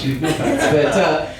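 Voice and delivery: ordinary talk, nothing marked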